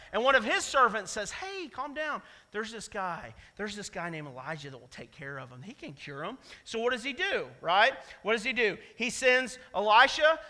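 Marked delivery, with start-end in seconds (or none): 1.3-6.4 calming servant. Retold or imitated. Servant calming the frightened king.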